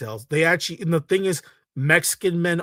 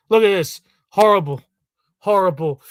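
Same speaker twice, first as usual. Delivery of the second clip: disgusted; 3 semitones higher, 6 dB louder than usual. Real, not acted. Reacting in disgust to clip.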